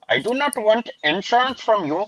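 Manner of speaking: high-pitched put-on Indian accent; mock Indian accent. Acted prank-call persona answering caller.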